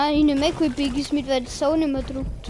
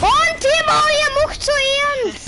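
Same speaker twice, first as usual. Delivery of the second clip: whiny voice; 11 semitones higher, 5 dB louder, slower than usual. Pretend. Complaining at teammate.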